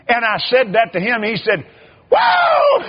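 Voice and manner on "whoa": very high, loud startled whoa; astonished shout of whoa. Retold or imitated. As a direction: yelp it out in shock. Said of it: "And he shouted".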